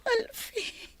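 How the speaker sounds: high, soft, sobbing plea of his name; tearful soft call; crying. Acted. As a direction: cry his name as he walks away. Lover leaving her.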